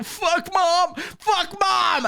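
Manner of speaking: very high strained yell; mock climax shout. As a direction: cry out in hammed-up climax. Acted climax cry.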